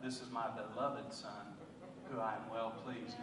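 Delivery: low, soft and tender, a quiet blessing; hushed voice of God. Retold or imitated. God softly blessing his son in the sermon. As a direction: speak it down gently like a loving father.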